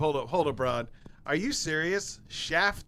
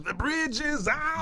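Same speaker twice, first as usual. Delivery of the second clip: mock dramatic warning; 11 semitones higher, much slower than usual. Dramatic joke about bridge danger.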